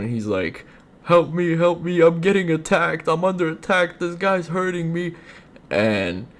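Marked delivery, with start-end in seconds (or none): 1.0-5.1 high-pitched put-on plea for help; mock distressed caller. Dude calling nine one one, hammed-up distress. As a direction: imitate a panicked victim in a high voice. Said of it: "he calls nine one one".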